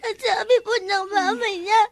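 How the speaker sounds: put-on child sobbing through an account; words broken up by sobs; tearful explaining. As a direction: report it to mother while crying. Crying child reports the accusation.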